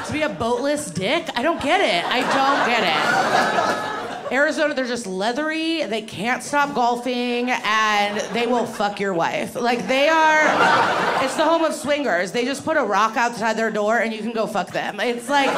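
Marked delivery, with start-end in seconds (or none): none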